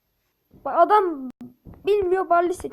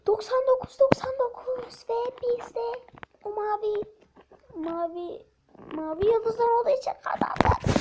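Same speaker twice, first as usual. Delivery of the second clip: excited boast; 8 semitones higher than usual. Real, not acted. Excited boasting about the win.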